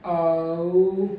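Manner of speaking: औ with relaxed, unrounded lips at the start; diphthong demo. Shown as a demonstration. Teacher models the right way to start औ. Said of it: "हम जब भी पहली sound की बात करें औ".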